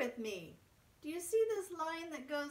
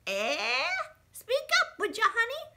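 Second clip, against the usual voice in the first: put-on high, loud old granny who can't hear; a boy at the door, his deaf granny answers loudly and high; 10 semitones higher, 6 dB louder than usual. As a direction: shout like an old lady hard of hearing. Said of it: "Granny could not hear very good".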